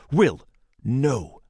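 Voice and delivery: loud, sharp warning cry; urgent shout. Acted. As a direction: stop him with a shout. Boy restrains Will.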